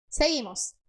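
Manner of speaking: ordinary talk, nothing marked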